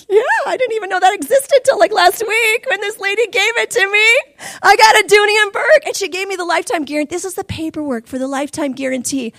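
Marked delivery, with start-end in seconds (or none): 0.1-5.9 giddy. Retold or imitated. Giddy reply to admirers.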